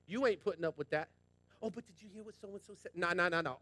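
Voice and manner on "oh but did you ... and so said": hushed and much softer, like passing a rumor; whispering busybody in the pews; mock gossip whisper. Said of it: "All that whispering's from Satan".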